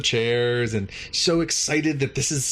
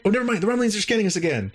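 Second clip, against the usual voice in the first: high, rushed mock alert; urgent interruption; 9 semitones higher, much faster than usual. Commando cutting the cadet off again, high rushed mock alarm. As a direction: blurt it over the other person, hammed up. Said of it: "interrupting her and saying".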